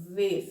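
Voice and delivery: "V" said as vê, with a closed E; low "V" with the vowel narrowed. Shown as a demonstration.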